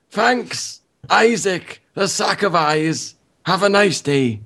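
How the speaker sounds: put-on high, strained old man voice. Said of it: "give him an old man voice".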